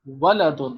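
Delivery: ولدن repeated with short wa-la; ولدن said clearly as wa-la-dun; short a in Waladun. Shown as a demonstration. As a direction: drill ولدن with short vowels. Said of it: "اور دوسرا ہے ولدن".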